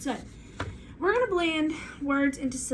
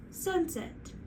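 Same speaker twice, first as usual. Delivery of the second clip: "Sunset" joined as one word, pitched higher; quick, higher "Sunset" with no gap; blended whole word; 5 semitones higher, 3 dB quieter than usual. Shown as a demonstration. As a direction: bring "Sunset" back together, lifted in pitch. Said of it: "Let's try sunset".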